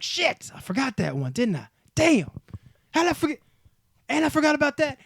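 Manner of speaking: high, exclaiming self-reproach; hammed-up "how'd I forget" outburst; mock dismayed realization. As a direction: act out kicking yourself, pitched high. Acts out his later regretful self.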